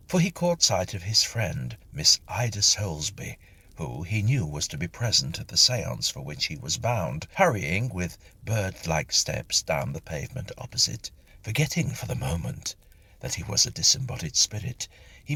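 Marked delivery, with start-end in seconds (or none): none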